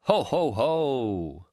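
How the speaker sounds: slow, booming ho ho ho laugh; hearty laugh. Acted. Ben laughing off his friend's fear of madness, hearty and slow. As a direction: let out a big slow belly laugh. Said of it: "Hearty laugh".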